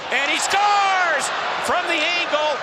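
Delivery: drawn-out high goal call; long goal yell. Real, not acted. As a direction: stretch out scores. Goal call by play-by-play announcer.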